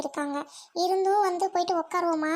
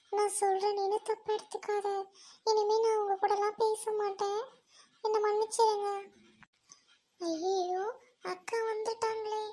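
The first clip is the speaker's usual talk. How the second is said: soft, subdued and level; quiet, defeated voice; sorrowful apology; 5 semitones higher, 4 dB quieter than usual. Acted. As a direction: apologize as if close to tears. Tearful apology, dreading sister's arrival.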